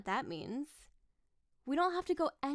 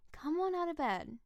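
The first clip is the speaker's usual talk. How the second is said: normal talking voice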